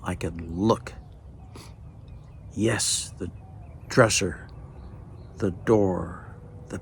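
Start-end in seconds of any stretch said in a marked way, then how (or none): none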